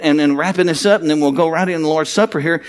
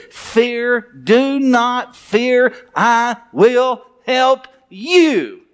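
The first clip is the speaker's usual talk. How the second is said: very slow, drawn-out divine promise; lightly voiced solemn God; a hint of God's voice; 5 semitones higher, much slower than usual. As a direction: lay each word down slowly like God speaking.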